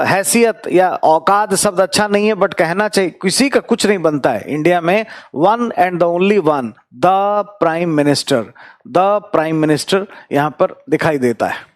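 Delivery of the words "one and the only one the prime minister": One and the only one drawn out very slowly; emphatic slow phrase. Shown as a demonstration. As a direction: slowly stress each word of the prime minister. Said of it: "emphatic slow build-up".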